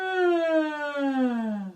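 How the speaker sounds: loud sighing yawn; drawn-out pretend yawn; acted yawn. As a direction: yawn like the snake.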